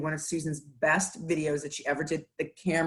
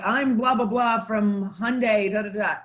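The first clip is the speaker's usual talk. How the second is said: put-on Boston accent; 5 semitones higher, 4 dB louder than usual. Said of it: "very heavy Boston accent".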